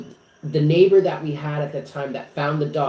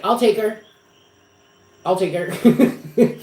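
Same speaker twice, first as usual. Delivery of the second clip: high, louder retold eagerness; eager offer; 6 semitones higher, 5 dB louder, slower than usual. Re-enacting an immediate offer to rehome Lucy, eager high voice. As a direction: jump at the offer, pitched up.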